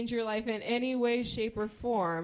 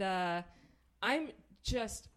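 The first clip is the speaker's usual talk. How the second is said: normal talking voice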